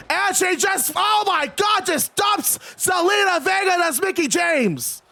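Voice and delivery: high-pitched announcer's outburst; excited play-by-play. Acted. Streamer calls Vega being dumped off ladder, shocked play-by-play. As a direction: erupt at a shocking move from ringside.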